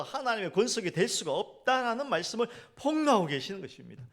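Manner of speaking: fervent preaching. Acted. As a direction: drive the point home from the pulpit. Preaching at full force.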